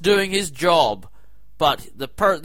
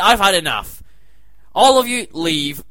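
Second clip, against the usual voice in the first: loud, fed-up order; mock fury at the co-hosts, loud; angry dismissal; 5 dB louder than usual. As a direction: throw them all out. Throwing everyone out.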